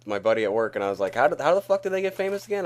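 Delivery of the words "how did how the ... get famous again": high, fast, baffled retelling; incredulous question. Retold or imitated. Telling his buddy at work he can't believe it, incredulous. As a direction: fire off the question in mock disbelief.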